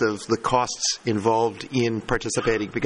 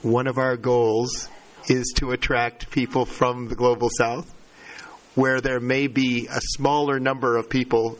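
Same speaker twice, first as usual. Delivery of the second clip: slowed down. Real, not acted. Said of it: "I'm sorry for talking so fast".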